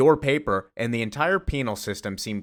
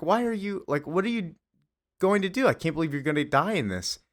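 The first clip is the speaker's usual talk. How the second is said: higher-pitched mock disbelief; retold incredulity; 7 semitones higher than usual. Clark asking Lex why he accepts dying, retold with incredulity. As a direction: voice a baffled question, lightly acted.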